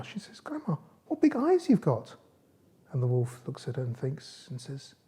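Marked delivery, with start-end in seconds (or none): 0.4-2.2 put-on very high, slow little-girl wonder; high, slow storybook girl; Little Red Rosenberg. A narrator's reading. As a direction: draw it out high as an amazed girl. Girl marvels at disguised wolf.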